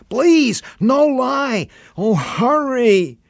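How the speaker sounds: put-on a kid desperate to be believed; pleading boy. A narrator's reading.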